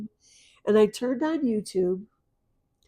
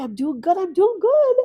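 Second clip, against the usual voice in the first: high retold voice, climbing higher and louder; daughter's reassurance; 10 semitones higher, 4 dB louder than usual. Mother voices her late daughter reassuring her, high and rising. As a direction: lift it into a high, insistent reassurance. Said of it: "she kept going".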